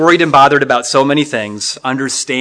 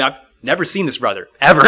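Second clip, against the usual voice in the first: normal talking voice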